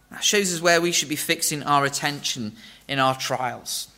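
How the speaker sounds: ordinary delivery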